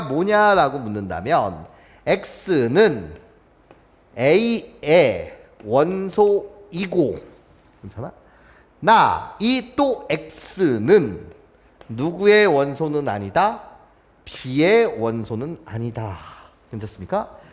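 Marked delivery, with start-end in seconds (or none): 2.1-7.2 very slow, drawn-out A의; slow demo reading. Shown as a demonstration. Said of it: "요렇게 여러분들 읽어주셔야 해요". 9.4-16.2 slow, deliberate reading with a questioning rise; drawn-out teacher reading; slow demo reading. Shown as a demonstration. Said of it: "요렇게 여러분들 읽어주셔야 해요".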